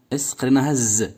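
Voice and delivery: ordinary talk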